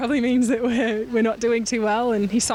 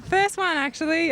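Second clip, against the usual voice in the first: excited; 8 semitones higher than usual. Real, not acted. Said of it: "so I'm, I'm very excited".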